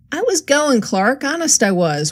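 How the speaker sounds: high-pitched pleading whine; whining. A narrator's reading. Supposed crook pleading with the detective sergeant, whining. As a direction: whine an excuse, starting high and easing down. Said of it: "whined Hickey".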